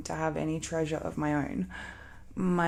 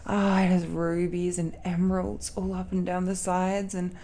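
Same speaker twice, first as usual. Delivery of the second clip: ordinary delivery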